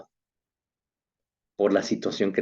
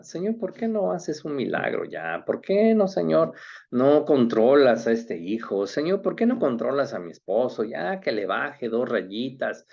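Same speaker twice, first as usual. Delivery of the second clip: impatient prayer; 6 semitones higher than usual. Retold or imitated. Voicing people's impatient prayers.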